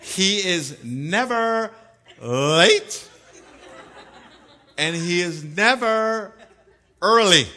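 never drawn out slowly, loud; slow emphatic. Acted. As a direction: hammer each never slowly.

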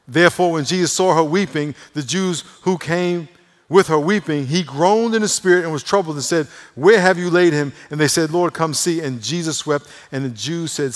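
high, loud scripture proclamation; forceful preaching. Real, not acted. Preacher reading of Jesus seeing her weeping, forcefully. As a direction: declaim the verses to the congregation.

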